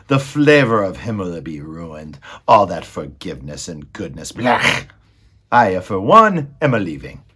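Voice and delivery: loud, disdainful villain rat; disgusted Botticelli. A narrator's reading. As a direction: sneer at it all with loud disgust.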